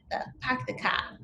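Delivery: Boston "the pack the ka" with no R sounds; "pack" and "ka" said as pahk and cah, Boston style; lightly done Boston accent. Shown as a demonstration. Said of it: "that real Boston accent".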